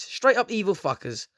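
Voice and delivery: usual speaking voice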